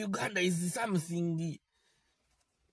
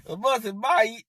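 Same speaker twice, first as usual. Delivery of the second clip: louder, brisk taunting goodbye; mock farewell; 3 semitones higher, 6 dB louder than usual. Telling the boss to leave, a louder jeering bye. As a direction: wave him off with a jeer.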